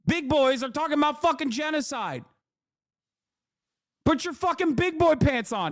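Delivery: high-pitched exasperated rant; scornful, worked-up dressing-down; indignant telling-off. Retold or imitated. Re-enacts telling off the panelist.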